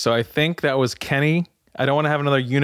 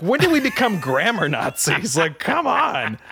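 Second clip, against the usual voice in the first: high, drawn-out protest with a laugh; playfully exasperated, pitch soaring at the end; mock exasperation; 6 semitones higher, slower than usual. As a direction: push back at nitpickers, laughing and incredulous. Retorts to family's grammar correction.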